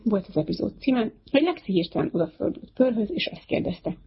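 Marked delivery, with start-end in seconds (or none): none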